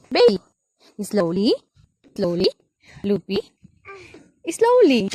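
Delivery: singsong coaxing with rising ends; short, punchy, lilting calls of encouragement; coaxing a pet. Real, not acted. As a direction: coax a little one up step by step.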